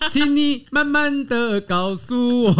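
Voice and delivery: slow, high mock-tender boyfriend; gentle boyfriend. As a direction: coax her like a patient partner. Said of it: "然后问你说：".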